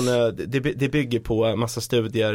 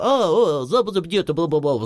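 high, louder mock foreign gabble; babbling shop staff; 6 semitones higher, 4 dB louder than usual. Traveller imitating shop staff gabbling among themselves, high-pitched mock chatter. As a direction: imitate them suddenly catching on and jabbering.

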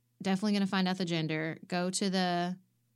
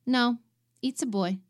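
put-on a firmer "it's a boy", a bit higher and louder on no; firm correction; 6 semitones higher, 6 dB louder than usual. Mexican tech firmly correcting the mother: firm insistence. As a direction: plant your feet and correct her. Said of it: "my insistence".

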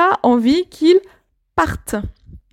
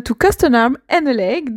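English spoken with a French accent; careful English, French accent. Real, not acted. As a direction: say it in English as a French speaker would. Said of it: "j'ai un magnifique accent, euh, anglais".

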